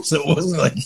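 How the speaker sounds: ordinary delivery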